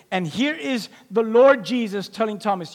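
normal talking voice

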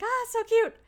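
squealed high and loud, cooing; high squeal of delight. Retold or imitated. Vlogger recalls seeing the alien snow globe, squealing high. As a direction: gush over the cute little snow globe. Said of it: "And I'm like".

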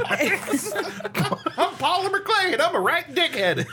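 high, laughing goofy character; silly character voice. Pretend. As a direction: introduce yourself as a giddy oddball. Playing the Polymer Clay character.

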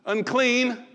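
loud, high repeated call; leper's cry. Acted. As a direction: call out the warning three times. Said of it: "I'm gonna remind you and everyone else".

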